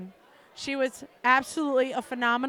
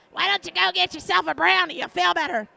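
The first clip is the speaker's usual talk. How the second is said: higher, loud tempting voice; loud coaxing friend; 5 semitones higher, 5 dB louder than usual. Retold or imitated. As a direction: egg her on, loudly. Friend tempting her on phone.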